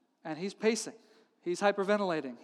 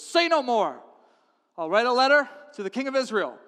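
louder, raised commanding voice; decisive king; 4 semitones higher, 6 dB louder than usual. Acted. King offering his general help, decisive. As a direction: cut in with royal confidence.

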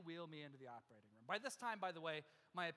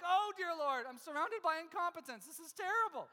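higher and louder, fed-up wail; exasperated outcry; 9 semitones higher, 9 dB louder than usual. Retold or imitated. Patient crying out at nurses missing his vein, exasperated and loud. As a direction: throw up your hands at the incompetence. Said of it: "And I'm like".

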